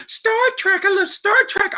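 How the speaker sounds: high, gushing fan voice; excited squeal. Real, not acted. Gushing about Star Trek.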